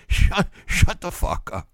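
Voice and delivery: put-on slow side-mouth toddler voice, broken by laughter; lightly voiced cursing toddler, slowed down; a hint of a toddler cursing from the corner of the mouth. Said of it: "out of the side of his mouth".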